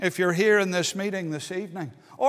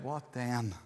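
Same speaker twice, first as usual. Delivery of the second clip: hushed final question; 9 semitones lower, 12 dB quieter than usual. Acted. Lawyer's final question in story.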